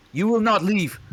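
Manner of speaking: high loud snapping threat; menacing shout. Acted. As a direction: bark the threat at them. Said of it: "Warforged threatens again".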